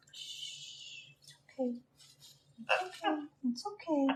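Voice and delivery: very soft shushing and reassurance; calming. Real, not acted. Owner trying to get her wild bird calm, soothing. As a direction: hush a restless pet gently. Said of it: "trying to get him calm".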